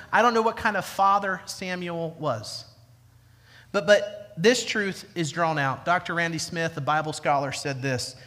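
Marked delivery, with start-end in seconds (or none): none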